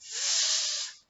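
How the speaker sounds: th, tongue between teeth; the sound th. Shown as a demonstration. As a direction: say S as a long breathy th. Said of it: "sound picture is called".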